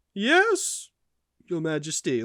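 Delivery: imitating an awkward high voice; put-on high yes that drops off after; awkward high-pitched. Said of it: "he just says it so awkwardly".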